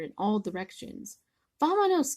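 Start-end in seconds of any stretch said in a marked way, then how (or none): 1.6-2.2 high, loud panicked cry. Acted. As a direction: cry out and flee. Chimichangos scatter in panic.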